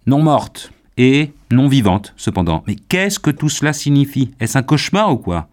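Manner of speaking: loud, high, bewildered questioning; agitated disbelief. Acted. Young man demanding what this nightmare means, agitated. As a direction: throw the words back in horrified disbelief. Bereaved man reacts in horror.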